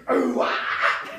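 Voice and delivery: grunting rock singer; mock metal vocalist grunt; [name] singer impression. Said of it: "my [name] impression".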